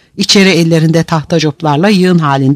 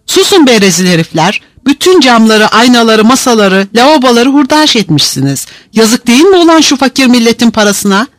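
high, raised scolding; furious roar; 7 semitones higher, 3 dB louder than usual. A narrator's reading. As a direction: sergeant losing patience and roaring. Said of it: "diye kükredi".

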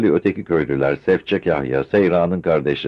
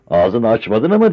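louder, higher angry yell; angry shout; 6 semitones higher, 6 dB louder than usual. A narrator's reading. Kamer shouting at Osman in anger. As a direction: fling it back at him. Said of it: "diye bağırdı".

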